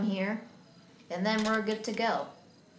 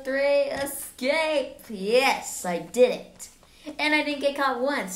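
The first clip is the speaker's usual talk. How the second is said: louder, thrilled shout of victory; triumphant cheer; 5 semitones higher, 4 dB louder, slower than usual. Real, not acted. Player escaping Granny's house, cheering loudly. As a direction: cheer like you just made it out alive.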